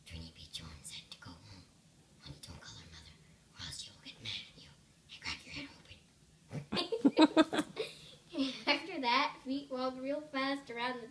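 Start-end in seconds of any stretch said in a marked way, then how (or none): 0.1-6.0 low, hushed spooky whisper; a creepy hidden whisper; scary voice. Acted. Junie B pretending to be someone else, spooky low whisper. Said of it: "in a scary voice". 6.5-8.6 loud burst of laughter. Real, not acted. Reader laughing at her own spooky voice, loud laugh.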